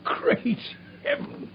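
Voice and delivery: stunned, whispered exclamation; whispered in shock. Acted. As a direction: gasp it out under your breath. Gregson shocked by the news.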